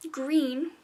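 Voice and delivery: plain reading voice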